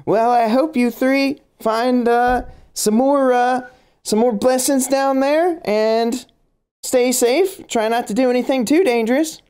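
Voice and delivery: high-pitched, lightly southern June. Acted. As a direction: sing it out kindly like a fretting aunt. GM voices June's farewell.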